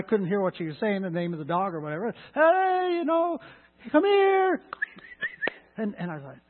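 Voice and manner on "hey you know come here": high, loud call. Retold or imitated. Woman across the lake calling her dog, high shout.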